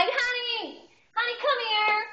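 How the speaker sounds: high, singing holler for a husband; acted wifely summons; calling out.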